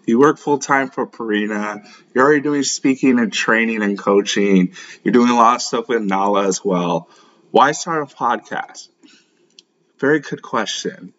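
usual speaking voice

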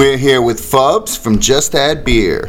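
slow, drawn-out radio announcer voice. Acted. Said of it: "sick of the radio announcer voice".

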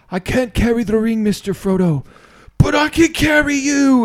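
impassioned, high-pitched hero's cry; Sam Gamgee. Acted. Quoting Sam to Frodo in a movie scene, high and impassioned. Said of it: "Sam looks at Frodo and says".

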